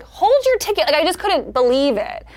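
mock-indignant high-pitched order; exasperated high squeal, retold; retold exasperation. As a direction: scold a stranger in a high voice. Retold reaction to a gross traveler.

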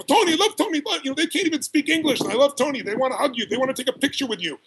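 a storyteller lightly voicing foreign fans who love Tony; mimicking eager fans. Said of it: "people go".

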